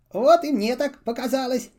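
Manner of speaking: cheerful little traveller agreeing; Half-Boot voice. A narrator's reading. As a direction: reader lightly voicing a boy.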